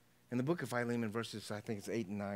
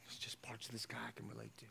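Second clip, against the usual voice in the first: near-whispered, private confession; very soft, breath-only repeat; hushed admission; 14 dB quieter than usual. Acted. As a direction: whisper it as if admitting it to yourself.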